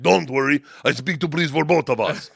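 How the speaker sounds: put-on confident Russian-accented gangster voice; Igor. Gangster to friend before meeting police, Russian accent. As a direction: imitate an unfazed Russian gangster. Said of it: "He's like".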